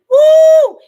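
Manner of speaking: loud, high "woo"; excited whoop. Real, not acted. Streamer celebrates making it onto YouTube, excited whoop. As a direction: let out a long, high whoop. Cheer while thanking [name].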